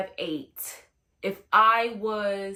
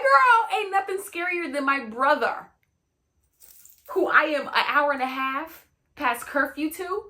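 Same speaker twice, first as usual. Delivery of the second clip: much higher and louder, incredulous; half-laughing mock outrage; exasperated exclaim; 6 semitones higher, 6 dB louder than usual. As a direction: blurt it out like she's lost her mind. Exasperated reply to friend.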